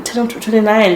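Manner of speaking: normal talking voice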